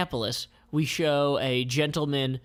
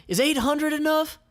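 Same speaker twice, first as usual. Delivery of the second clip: high, keen offer; eager buyer; 5 dB louder than usual. Acted. Band member offering money, high keen. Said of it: "then another one".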